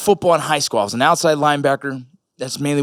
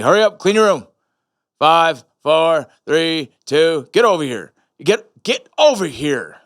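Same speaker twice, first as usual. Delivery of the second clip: louder, drawn-out drill sergeant commands; military-style dad; 3 semitones higher, 4 dB louder than usual. Retold or imitated. Dad ordering his kids to clean up, military style. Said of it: "military almost style".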